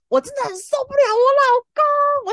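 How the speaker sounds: wife can't stand her husband, loud exasperated cry; exasperated outburst. Real, not acted. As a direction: let the frustration explode. Venting about her husband.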